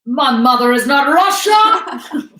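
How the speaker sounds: loud, slow, high declaration; hammed-up indignant proclamation; mock outcry. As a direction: cry it out like a melodrama line. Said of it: "She's like".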